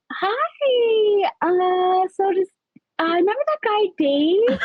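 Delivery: high, drawn-out hesitant hello; retold awkward approach. Asking her new friend about Dave, high and hesitant. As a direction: tiptoe into the question in a high voice.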